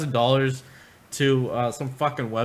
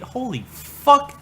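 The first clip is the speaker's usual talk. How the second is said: drawn-out disbelief; 10 semitones higher, 7 dB louder, much slower than usual. Real, not acted. Reacting to creepy tweet.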